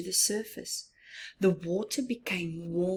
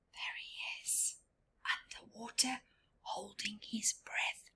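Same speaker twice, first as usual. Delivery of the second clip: soft unvoiced whisper, much quieter; breathless hushed whisper; 14 dB quieter than usual. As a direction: whisper as if underwater with him. Whispering while diver is underwater.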